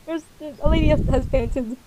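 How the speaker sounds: normal talking voice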